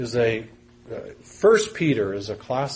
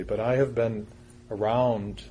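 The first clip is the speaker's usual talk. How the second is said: usual speaking voice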